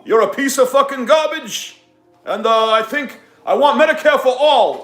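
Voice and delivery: high, loud politician's rant; Bernie Sanders. Acted. Streamer insulting a viewer as a politician, loud high impression. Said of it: "Bernie Sanders".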